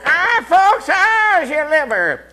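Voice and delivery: high, squeaky puppet voice; dummy saying hello to the folks, high and loud; ventriloquist dummy voice. Acted. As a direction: play the cheeky dummy greeting the folks. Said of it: "Go ahead and say hello to the folks".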